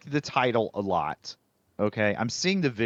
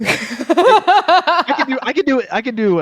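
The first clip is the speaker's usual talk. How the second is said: high, laughing and louder; 12 semitones higher, 9 dB louder, slower than usual. Real, not acted. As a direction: laugh while blurting it out. Laughing at math joke.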